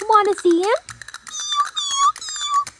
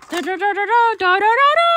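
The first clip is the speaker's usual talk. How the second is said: ordinary delivery, nothing marked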